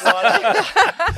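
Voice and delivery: high-pitched, delighted cackle; real burst of high laughter. Real, not acted. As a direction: crack up in a high peal. Teased about climbing the mountain.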